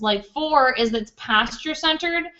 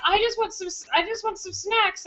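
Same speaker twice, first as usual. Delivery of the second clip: whiny; 9 semitones higher, 4 dB quieter than usual. Retold or imitated. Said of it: "he was like".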